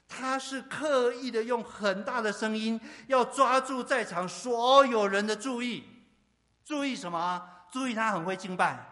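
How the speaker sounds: loud, high-pitched sermon delivery; impassioned preaching. Real, not acted. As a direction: preach at full force, driving each phrase home. Preaching at full force.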